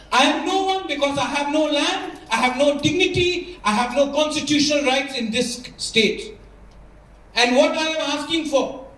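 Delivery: slow, fervent appeal to the council; impassioned speech. Real, not acted. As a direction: plead for his people, every word weighed. (धीमा, भावुक भाषण)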